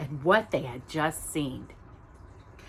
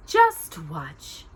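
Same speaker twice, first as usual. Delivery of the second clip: brightly. Acted. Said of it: "the woman said brightly".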